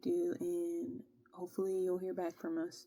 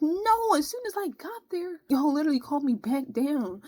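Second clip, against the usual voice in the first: much higher and louder protest that sinks into a whisper; indignant retort; 9 semitones higher, 9 dB louder than usual. Retold or imitated. Retelling the recruiter calling her back down, high indignant protest. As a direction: squeak out the complaint, then whisper the end. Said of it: "I'm like".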